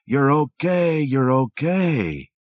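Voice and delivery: soothing. A narrator's reading. Husband soothing woken wife.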